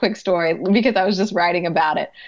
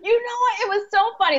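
laughing; 13 semitones higher than usual. Real, not acted. Laughing recalling patient story.

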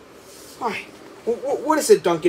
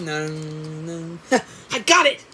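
normal talking voice

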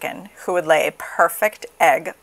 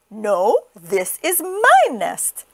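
possessive hen; 3 semitones higher, much slower than usual. Acted. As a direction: insist as the chicken. Chicken claims the nest.

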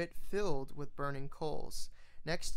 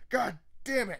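angry curse; 7 semitones higher, 3 dB louder than usual. Acted. Courier choking in smoke.

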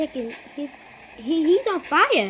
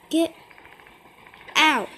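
ordinary talk

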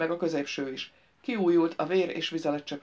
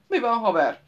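loud, higher growled challenge; mild growl; 5 semitones higher, 7 dB louder than usual. A narrator's reading. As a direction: growl it out at a stranger. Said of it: "mordult Nogentől".

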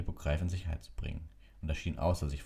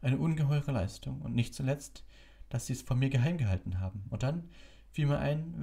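put-on gentle wise old wizard; 10 semitones higher than usual. A narrator's reading. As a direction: gently reassure him. Dumbledore voiced in story.

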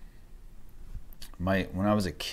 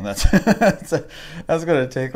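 laughing; 9 semitones higher, 11 dB louder than usual. Real, not acted. Laughing at own tattoo ambitions.

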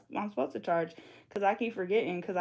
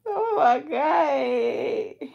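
tearful; 6 semitones higher, 6 dB louder, much slower than usual. Real, not acted. Overwhelmed by fan.

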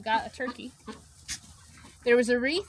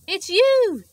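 surprised; 9 semitones higher, 11 dB louder than usual. Acted. Porcupine's surprised line.

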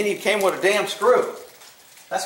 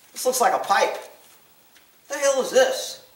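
usual speaking voice

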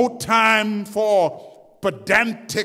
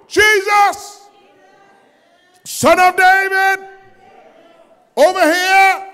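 high, loud desperate cry; shouting; 9 semitones higher, 7 dB louder than usual. A narrator's reading. Blind man shouting for Jesus over the crowd, loud plea. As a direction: yell to be heard over everyone.

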